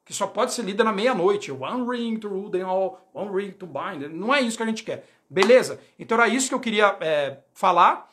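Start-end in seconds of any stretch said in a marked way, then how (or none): 1.5-4.1 a hint of a solemn incantation, slow; softer, slow mock-mystic chant; mock elvish incantation. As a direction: lightly intone it like an ancient elvish rune. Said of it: "runa élfica".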